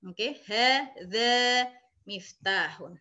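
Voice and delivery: "hadza miftahun" spoken slowly, each syllable clear; Arabic model reading. Shown as a demonstration. Teacher to online students learning Arabic, slow clear model reading. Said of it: "teacher models Arabic reading".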